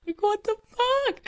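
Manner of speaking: very high, disbelieving outburst; incredulous exclamation. Real, not acted. Telling the story, shrieking in disbelief at the family. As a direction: squeak it out in disbelief. Exasperated aside.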